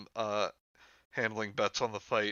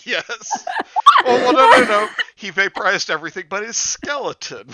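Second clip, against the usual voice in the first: loud, high laughing talk; 14 dB louder than usual. Real, not acted. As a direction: blurt it out through laughter. Laughing over vaporized character.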